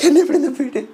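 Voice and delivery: high mock plea; first, milder plea to Bigg Boss; pleading Jasmine. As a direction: whine to be let out. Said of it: "അപ്പൊ Jasmine വീണ്ടും".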